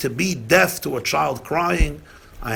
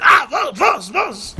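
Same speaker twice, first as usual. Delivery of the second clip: high, loud alarmed cry; startled grandfather asking what happened; 6 dB louder, slower than usual. Retold or imitated. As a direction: rush in worried, calling out.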